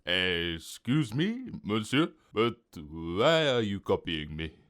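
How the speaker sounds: slow-starting, affronted French waiter; shocked French waiter. A narrator's reading. Waiter shocked by the copycat's answer, French-accented protest. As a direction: question a customer in a French accent. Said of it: "The waiter was shocked".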